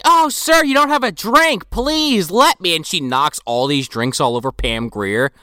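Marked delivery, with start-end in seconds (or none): 0.0-2.7 high-pitched mock hostess voice; hammed-up sugary insistence; fake-polite offer. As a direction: imitate an overly eager, put-on politeness. Acting Meg's fake offer of drinks.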